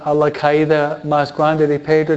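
ordinary delivery

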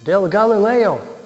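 high, slow, put-on voice of an accuser in the crowd; a stranger's high, drawn-out accusation; accusing bystander. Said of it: "Uno dice".

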